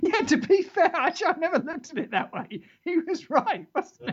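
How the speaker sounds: high mock earnest agreement; mock sincere. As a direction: pretend to be won over.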